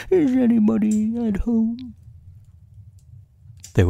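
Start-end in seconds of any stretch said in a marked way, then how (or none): 0.1-2.0 high bear voice calling out; Pooh. A narrator's reading. Bear calling into a rabbit hole, high-pitched call. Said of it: "and called out".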